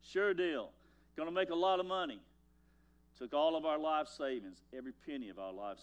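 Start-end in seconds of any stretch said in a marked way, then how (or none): 0.0-2.2 higher and louder, a cocky salesman's pitch; mock confident boast. Pastor mocking his younger self's land deal, cocky. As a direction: ham up the overconfident investor.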